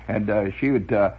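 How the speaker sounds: ordinary delivery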